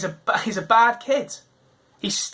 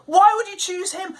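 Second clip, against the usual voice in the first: indignant crowd; 10 semitones higher, slower than usual. Retold or imitated. Crowd objecting to Jesus dining with Zacchaeus, high indignant cry. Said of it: "they respond like we'd respond".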